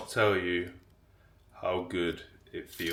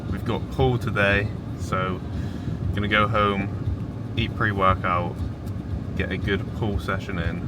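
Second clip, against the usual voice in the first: excited; 2 semitones higher, 9 dB louder than usual. Real, not acted. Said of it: "I'm excited".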